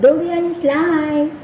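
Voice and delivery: slow, high sing-song catchphrase; lightly sung-out slogan. Retold or imitated. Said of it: "Panay ko pa naman sabi".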